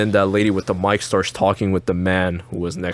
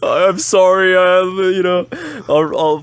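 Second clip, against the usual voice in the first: laughing apology; 9 semitones higher, 5 dB louder than usual. Real, not acted.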